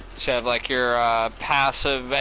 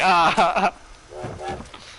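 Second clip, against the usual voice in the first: higher-pitched, loud laugh; amused laugh; 5 semitones higher, 3 dB louder than usual. Real, not acted. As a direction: laugh loudly after telling the funny bit. Laughing after retelling the funny moment.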